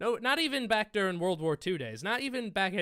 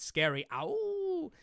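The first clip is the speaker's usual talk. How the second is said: mock scared; 13 semitones higher, much slower than usual. Mocking the wolf ship.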